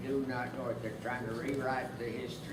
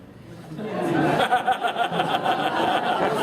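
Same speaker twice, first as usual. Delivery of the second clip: big laugh; 9 semitones higher, 13 dB louder than usual. Real, not acted. Old veteran laughing at his own joke, big breathy laugh.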